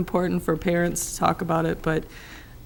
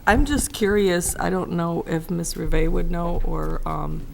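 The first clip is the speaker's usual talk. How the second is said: ordinary talk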